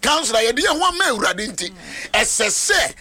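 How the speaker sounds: high, impassioned preacher's voice; fervent preaching. Real, not acted. Preacher telling the counselor of giving themselves to God, fervent preaching.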